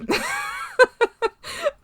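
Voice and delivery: long high rising giggle. Real, not acted. Laughs after gushing.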